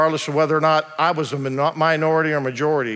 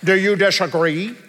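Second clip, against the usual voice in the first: imitating the lawyer. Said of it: "she didn't talk like that".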